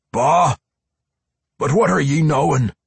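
snapping back, high and loud; irritable dwarf retort; Pwent. A narrator's reading. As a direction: bark it back as an indignant dwarf. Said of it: "Pwent snapped back".

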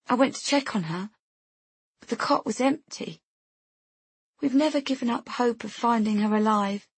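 grief-stripped voice. A narrator's reading. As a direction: plead with worn, understated grief. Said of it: "her voice stripped bare and scoured by grief".

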